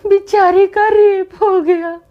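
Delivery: high, slow, sobbing words; imitating her mother-in-law sobbing over a serial; crying. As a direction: wail it out through tears. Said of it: "रो क्यों रहे हो आप?".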